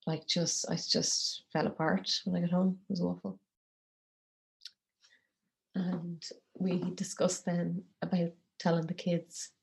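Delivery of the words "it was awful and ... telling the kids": breaking into a whisper, holding back tears; real grief pushing the voice down to a whisper; emotional whisper. Real, not acted. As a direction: barely get the words out, choked up. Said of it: "I just need a second".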